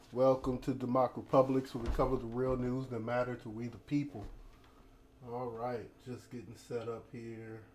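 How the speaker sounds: usual speaking voice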